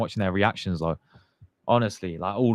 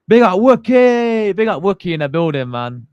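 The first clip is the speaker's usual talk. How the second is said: hyped shout-out; 9 semitones higher, 9 dB louder than usual. Real, not acted. Hyped greeting to a returning viewer.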